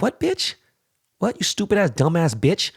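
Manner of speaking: high, incredulous angry yelp; furious outburst. Real, not acted. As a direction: voice shooting way up in outrage. Ranting at the assistant.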